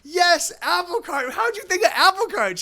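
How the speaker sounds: high giddy wonder; euphoric. Retold or imitated. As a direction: gush with tripping delight. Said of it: "And I go".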